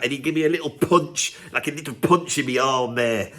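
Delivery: ordinary talk, nothing marked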